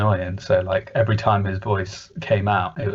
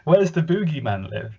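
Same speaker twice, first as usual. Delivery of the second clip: higher, playfully baffled questions; mock bewilderment; 7 semitones higher than usual. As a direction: ask as if hopelessly lost, lightly hammed up. Acting out confusion while watching episode.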